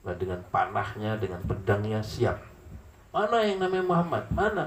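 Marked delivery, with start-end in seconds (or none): 3.1-4.6 challenging warrior call. Acted. Preacher voicing enemy champion calling out Muhammad, high mock challenge. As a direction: call out for Muhammad like a cocky fighter.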